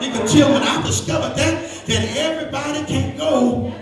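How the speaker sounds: loud, high, hammering preacher cadence with uh breaks; sermon climax, calling the church to answer; fervent preaching. Acted. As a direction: preach it hard to the congregation. Preaching at full force.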